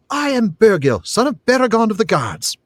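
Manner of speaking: put-on high, ringing boy's declaration; high-pitched proud introduction; proud lad. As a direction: announce yourself proudly as a young boy. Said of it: "drew himself up proudly".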